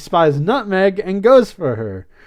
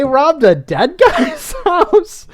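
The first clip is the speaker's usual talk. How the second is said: slow, much higher, cracking up with laughter; laughing in disbelief; 10 semitones higher, 4 dB louder, slower than usual. Real, not acted. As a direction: laugh through it, incredulous. Amused disbelief at the plot.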